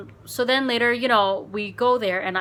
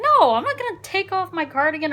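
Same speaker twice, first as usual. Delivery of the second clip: indignant retold refusal; 10 semitones higher, 6 dB louder than usual. Retelling refusing a pushy date.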